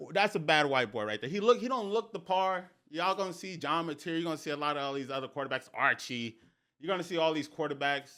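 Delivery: usual speaking voice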